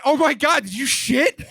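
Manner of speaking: high-pitched, incredulous outburst; shocked wife. Retold or imitated. Retold wife's shocked reaction.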